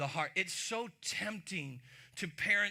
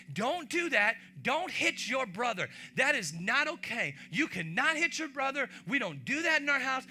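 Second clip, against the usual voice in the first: put-on higher and louder scolding of a child; scolding parent; 6 semitones higher, 5 dB louder than usual. Parent scolding a kid for hitting his brother, louder. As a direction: lecture the kid firmly. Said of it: "You could say to your kids".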